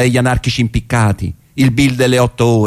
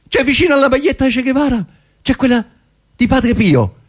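much higher, fast, incredulous; astonished. Acted. Man spotting Padre Pio beside Che at the stall, astonished and much higher. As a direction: blurt it out in disbelief. Shocked by the T-shirts.

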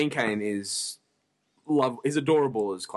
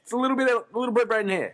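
high squeaky voice; 11 semitones higher, faster than usual. Real, not acted. High joking voice.

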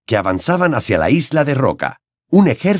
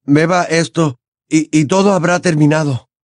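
put-on higher, pressing young voice; urging Harry; 6 semitones higher than usual. Harry pushing Dumbledore to drink, urgent. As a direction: coax him through it. Harry pleading through fear.

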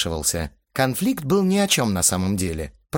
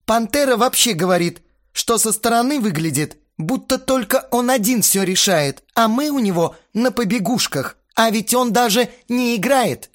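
high, loud indignant tirade; heated complaint; 13 semitones higher, 5 dB louder than usual. A narrator's reading. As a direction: pour out the grievance loudly. Astarot keeps yelling his complaint. (возмущённая тирада на повышенных тонах)

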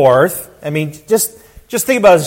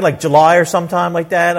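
ordinary talk

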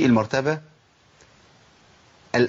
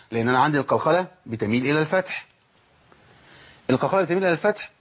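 ordinary talk, nothing marked

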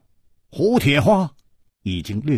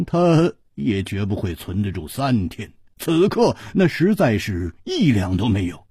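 usual speaking voice

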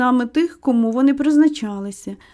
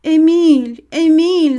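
loud, higher-pitched cry of a name; raised, ringing call; excited shout; 4 semitones higher, 6 dB louder, slower than usual. A narrator's reading. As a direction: shout the name out to greet him. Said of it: "закричала Джозі".

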